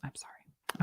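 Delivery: very soft, whispered mock apology; meek apology. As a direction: shrink into a tiny whisper, as if told off. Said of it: "And I was like".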